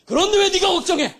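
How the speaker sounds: high, loud, booming challenge. Retold or imitated. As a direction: shout it down from heaven. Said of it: "더 큰 소리".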